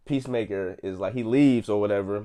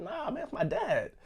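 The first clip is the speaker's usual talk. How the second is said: laughing quote; 4 dB quieter than usual. Retold or imitated. Quoting John Cena's reply.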